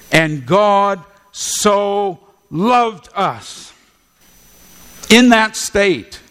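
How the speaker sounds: very slow "loved", rising at "in that state"; slow emphasis. Shown as a demonstration. Preacher stressing God's love for sinners, slow and emphatic. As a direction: stretch "loved" slowly, then lift "in that state". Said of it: "That's the emphasis being made here".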